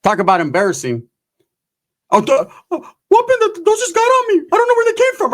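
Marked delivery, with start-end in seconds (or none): 2.1-5.3 very high, stammering falsetto excuse; squeaky embarrassed cousin. Acted. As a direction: squeal your flustered denial.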